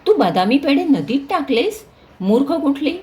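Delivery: mother scolding daughter for throwing sweets in river. A narrator's reading. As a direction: tell her off sharply. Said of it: "आई नीलावर ओरडली".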